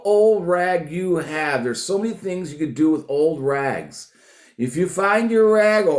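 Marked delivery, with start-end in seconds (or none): none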